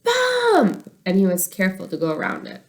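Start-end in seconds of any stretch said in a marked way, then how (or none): 0.0-0.9 much higher, louder shrieking call. Acted. Little sister warning of a bump, shrieking. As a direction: shriek the warning like an excited kid. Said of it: "she would shriek".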